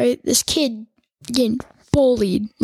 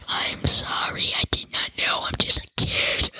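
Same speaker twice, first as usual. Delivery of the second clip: whimpering whisper; 4 dB quieter than usual. Pretend. Mock apology about copyright.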